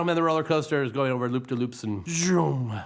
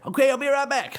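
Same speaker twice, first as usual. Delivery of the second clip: higher, chirpy country voice; eager Mater; 12 semitones higher than usual. Acted. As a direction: rush it out eagerly.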